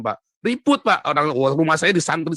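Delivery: ordinary delivery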